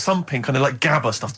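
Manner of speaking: ordinary talk, nothing marked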